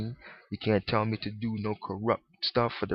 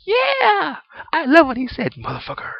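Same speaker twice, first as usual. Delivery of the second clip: much higher, louder cheering mock yell; mock shout; 9 dB louder than usual. Moviegoer cheering an actor's cussing, loud mock yell. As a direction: whoop it like a fan at the movies. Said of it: "cuss like a motherfucker and say".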